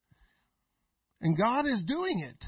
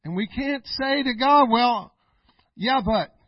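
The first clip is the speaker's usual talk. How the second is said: louder, calling out the excuse to God; loud preaching call; 8 dB louder, slower than usual. Acted. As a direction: belt it at the congregation.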